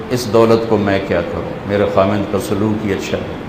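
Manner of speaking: low, subdued mock lament; retold tearful lament. Rich man's wife laments her husband's treatment to the speaker, retold tearfully. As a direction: quietly imitate a woman's tearful complaint. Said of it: "آتے ہی رونا شروع کر دیا".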